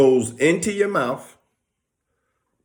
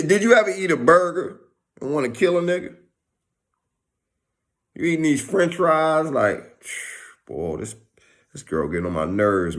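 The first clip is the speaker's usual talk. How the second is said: usual speaking voice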